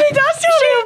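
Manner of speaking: excited squeal. Retold or imitated. Mimicking teammate's goal celebration.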